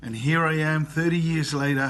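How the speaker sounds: ordinary talk, nothing marked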